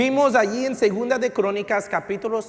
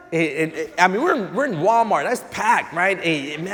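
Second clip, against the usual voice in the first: normal talking voice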